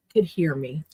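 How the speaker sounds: ordinary delivery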